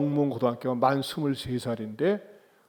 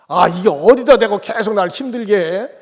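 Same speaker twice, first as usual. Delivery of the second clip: loud, raised-pitch acted complaint; exasperated yelling; 5 semitones higher, 8 dB louder than usual. Peter scolds the girl who keeps pursuing him, exasperated yell. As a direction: snap loudly at a pest as Peter. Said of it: "막 소리소리 질렀어요".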